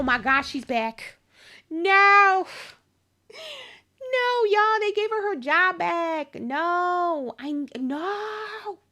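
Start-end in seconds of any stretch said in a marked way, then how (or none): 1.7-8.8 loud, high cry of disbelief; real dismay. Real, not acted. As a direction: wail it out in shocked protest. Streamer dismayed at character's return.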